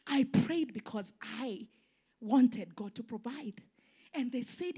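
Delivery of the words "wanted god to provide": ordinary talk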